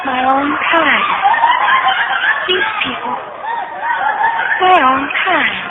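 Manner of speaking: laughing bitterly to herself. Acted. Virginia looking at the patients, bitter laugh. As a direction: laugh at the irony.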